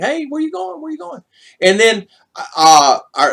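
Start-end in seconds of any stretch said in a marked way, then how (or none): 0.0-1.2 much higher, cheerful mock local calling out; imitating friendly locals. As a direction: pitch way up like a neighbour hailing you. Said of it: "they just wanna communicate".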